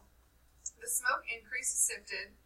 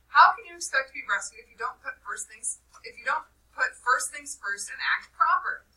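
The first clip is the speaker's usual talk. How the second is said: loud, scolding questions; angry tirade; 4 semitones higher, 8 dB louder than usual. Acted. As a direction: lecture the boys in anger. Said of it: "listening to the tirade".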